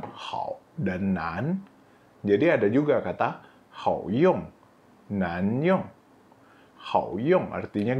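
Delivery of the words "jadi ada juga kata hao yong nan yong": ordinary talk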